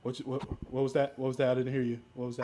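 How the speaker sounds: normal talking voice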